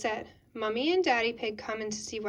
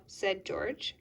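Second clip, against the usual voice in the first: ordinary delivery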